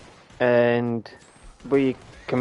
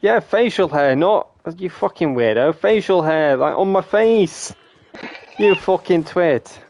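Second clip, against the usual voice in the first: higher, exasperated clarification; exasperated correction; 4 semitones higher, slower than usual. Real, not acted. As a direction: spell it out to a twit, drawing out the key words. Exasperated at being misunderstood.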